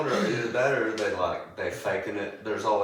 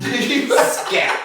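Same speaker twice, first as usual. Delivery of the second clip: a sudden high, loud laughing "Scat!"; mock shoo; 13 semitones higher, 8 dB louder than usual. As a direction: shoo him off as a joke, half laughing. Shooing off a homeless man.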